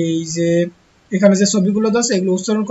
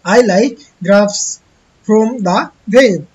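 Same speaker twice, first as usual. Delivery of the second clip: very slow, word by word, a bit louder; slow careful reading; 5 dB louder, much slower than usual. Shown as a demonstration. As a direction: read it out slowly for young learners. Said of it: "অর্থাৎ I feel fine।".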